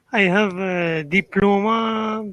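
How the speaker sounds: usual speaking voice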